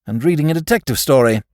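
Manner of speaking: ordinary delivery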